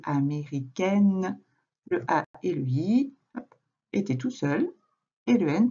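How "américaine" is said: "américaine" said slowly, each part clear; slow demo word. Shown as a demonstration. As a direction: draw out "américaine" for the child. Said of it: "on entendait le est tout seul". ("américaine" prononcé lentement)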